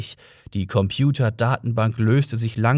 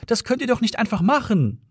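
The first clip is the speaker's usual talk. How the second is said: protesting cry; 10 semitones higher than usual. A narrator's reading. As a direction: protest across to them. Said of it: "rief Trillian".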